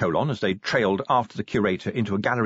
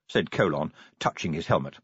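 plain reading voice

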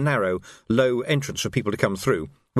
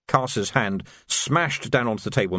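plain reading voice

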